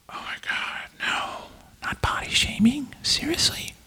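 sarcastic breathy gasp of shock; fake-clutch pearls, whispered; mock outrage. Mock outrage at body shaming.

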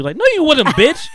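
high, loud, outraged call-out of a fake contestant; tearing into her, voice up and loud; angry rant. Real, not acted. Host fuming at a contestant who faked her feelings, loud angry rant. Ranting at a dating-show contestant.

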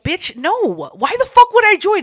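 high, loud outraged no; indignant refusal. Retold or imitated. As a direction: shout it down in disbelief. Refusing coworker's invite.

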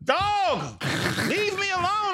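high-pitched yell. Real, not acted. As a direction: yell it back, irritated. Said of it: "Stop yelling at me".

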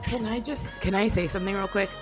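usual speaking voice

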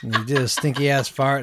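ordinary delivery

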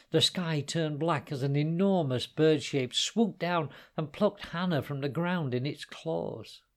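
usual speaking voice

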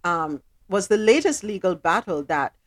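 measured, sober news read. Acted. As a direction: deliver the story straight. Reads the news.

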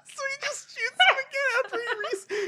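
high laughing, near tears; laughing hard. Real, not acted. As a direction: talk through helpless laughter. Laughing hard while commenting.

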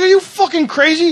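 outraged. Retold or imitated. Driver yelling at the cop about the heroin claim, outraged. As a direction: fire it back in total disbelief. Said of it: "I was like".

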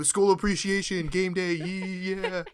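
high, loud pep-rally cheer; mock school cheer. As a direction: cheer it out high, drawing out the end. Imagined green-haired student cheering at game day.

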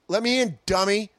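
high urgent call to be let in. Retold or imitated. Locked out, calling to a friend, high call. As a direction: call through the window to be let in.

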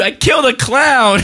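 put-on high, slow proud child; proud kid. Kid answering.

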